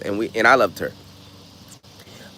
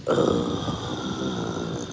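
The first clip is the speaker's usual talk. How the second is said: low grumbling growl for the dog; dog growl imitation; 9 dB quieter than usual. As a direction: grumble like a sulky puppy. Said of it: "and she said".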